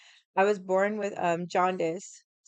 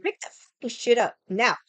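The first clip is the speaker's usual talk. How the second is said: angry order; 5 semitones higher, 7 dB louder than usual. Retold or imitated. Ordering grandpa to clean up.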